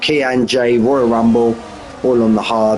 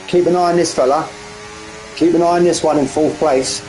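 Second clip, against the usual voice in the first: ordinary delivery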